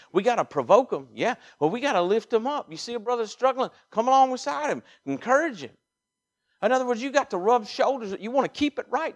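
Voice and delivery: high, loud, urgent exhortation; full-force preaching. Real, not acted. Preacher urging church to lift struggling brothers, impassioned preaching. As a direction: drive it home to the congregation at full voice.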